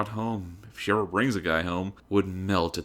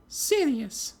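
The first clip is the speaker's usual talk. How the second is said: haughty heiress greeting. A narrator's reading. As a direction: greet her rival from on high. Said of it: "Weiss answered".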